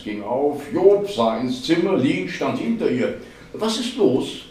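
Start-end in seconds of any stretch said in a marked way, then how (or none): 3.5-4.5 high-pitched, cheerful question. A narrator's reading. Woman looking in on puzzled colleagues, cheerful. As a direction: chirp it merrily in a raised pitch. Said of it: "fragte sie fröhlich".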